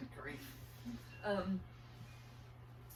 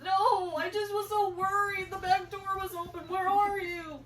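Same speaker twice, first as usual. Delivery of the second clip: high, loud distraught pleading; crying, frantic; 10 semitones higher, 8 dB louder than usual. Retold or imitated. Mom pleading with found daughter, crying. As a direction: re-enact a worried mother's tearful wail. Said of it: "I'm crying because she thinks".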